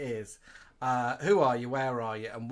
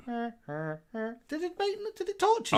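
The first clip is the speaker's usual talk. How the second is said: high toy voice; 10 semitones higher, 3 dB louder, slower than usual. Retold or imitated. Imitating toy voice.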